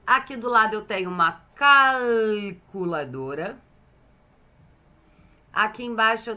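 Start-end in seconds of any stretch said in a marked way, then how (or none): 1.5-3.6 "calculadora" with a long held "cal"; drawn-out syllables. Shown as a demonstration. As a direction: stretch the first syllable of "calculadora", sounding the L. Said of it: "Falei sílaba por sílaba".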